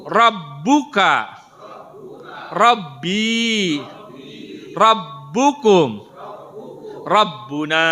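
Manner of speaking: slow, loud, drawn-out words for the class to repeat; slow drill recitation. Shown as a demonstration. Teacher leading the class to read the words together, slow and loud. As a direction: lead the class word by word, slowly and loudly. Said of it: "sekarang kita pakai gerakan".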